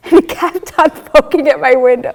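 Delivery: loud, laughing telling; amused. Real, not acted. Speaker recalling the persistent hummingbird at her window, amused.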